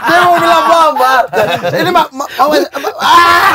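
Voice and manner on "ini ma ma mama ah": loud, high-pitched stammer ending in an outburst; Dev Liber. Retold or imitated. Comic imitating a comedian fighting his tongue, frustrated stammer. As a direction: trip over the word and explode at your own tongue. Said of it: "Dia emosi dengan lidah sendiri".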